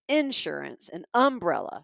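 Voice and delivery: IN-surance and UM-brella; Southern front stress on "insurance" and "umbrella"; first-syllable stress. Retold or imitated. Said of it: "emphasis on the first syllable".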